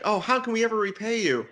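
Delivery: high, quick mock-grateful gush; hammed-up, hurried gratitude. Acting the grateful hosts.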